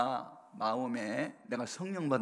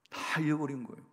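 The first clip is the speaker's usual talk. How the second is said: ordinary delivery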